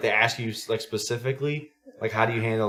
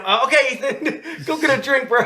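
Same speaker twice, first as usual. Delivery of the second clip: cracking up in a forced-nice voice; sheepish coach, laughing; 14 semitones higher, 6 dB louder than usual. Retold or imitated. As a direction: laugh while covering for your own slip. Coach remembers kid still running, laughing.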